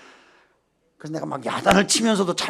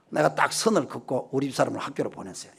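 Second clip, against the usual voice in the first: ordinary delivery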